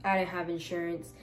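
ordinary talk, nothing marked

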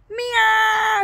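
shrieking high meow; high cat meow. Pretend. Vlogger meowing at her cats, high shrill meow. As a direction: cry out like a cat. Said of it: "Say meow".

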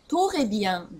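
"トレビアン" with a stressed "to" at the start; "トレビアン" in Japanese-style open syllables; wrong, vowel after T. Shown as a demonstration. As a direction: say "トレビアン" with O splitting T from R. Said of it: "トレビアンではない音".

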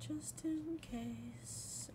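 breathy high whisper. Shown as a demonstration. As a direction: whisper it, drawn out slowly. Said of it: "repeated in whisper".

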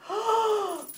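big high theatrical gasp; mock surprised gasp. Mommy revealing River's squirrel toy, mock surprised gasp. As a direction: gasp in pretend amazement.

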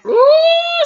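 high, loud drawn ooh; gleeful surprise. Real, not acted. Vlogger reacting to the argument, high loud ooh. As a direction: squeal it out delighted.

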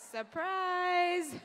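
high, loud "surprise" call; playful surprise. Pretend. Speaker jokingly surprises audience who thought they were leaving, playful high shout. Springs her appearance on departing audience.

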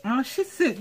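ordinary delivery

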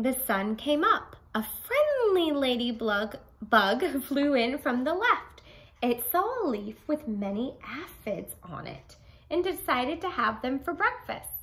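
put-on bright, lively read-aloud for children; storybook reading. A narrator's reading. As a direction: read it big for a class of kids. Teacher reads story aloud.

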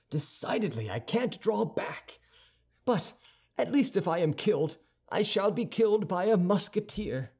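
high-pitched, spirited resolve; young Gascon resolving. A narrator's reading. D'Artagnan to himself before his duels, resolute. Voices D'Artagnan's thought aloud.

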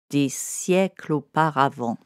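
plain reading voice

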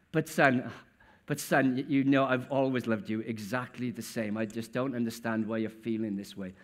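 calm the son down lovingly; father gently pleading with his angry son; gentle pleading father. Acted.

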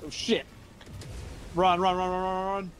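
urgent panicked run calls; hurried, fleeing in a rush; being chased. Real, not acted. Fleeing enemies in game.

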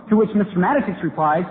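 usual speaking voice

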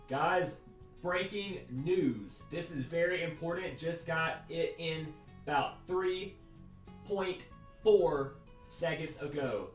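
slow dramatic announcement; breaking news anchor. Pretend.

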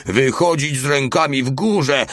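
high, loud barking police order; police chief roar. A narrator's reading. Mały playing police chief, roaring at the guards. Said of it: "ryczał głosem szefa policji".

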